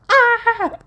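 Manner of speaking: a high, loud squeal breaking into a real laugh; squeal into laughter. Real, not acted. Reader laughing about her own giggle fits, high squealing laugh. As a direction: let the squeal tip over into laughing.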